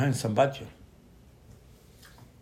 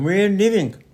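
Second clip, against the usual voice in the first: higher, louder, defiant "we're leaving"; defiant declaration; 7 semitones higher, 9 dB louder, slower than usual. Retold or imitated. People defying Moshe, louder and higher. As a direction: announce it like a group walking out.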